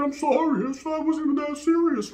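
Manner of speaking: high, pleading whine. Retold or imitated. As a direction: beg her to come back. Begging his lady to come back.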